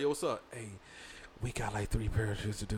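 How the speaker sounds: very soft, low impression of a quiet talker; imitated low voice. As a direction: drop low and quiet, mimicking him. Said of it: "the lower he gets".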